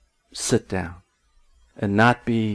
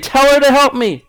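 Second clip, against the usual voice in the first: much higher and louder, an exasperated cry; angry outcry; 7 dB louder than usual. Acted. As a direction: cry out for help, fed up. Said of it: "She's agitated".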